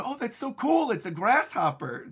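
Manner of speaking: high, thrilled acted outburst; mock freak-out of delight. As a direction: gush like an excited fan. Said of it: "him freaking out going".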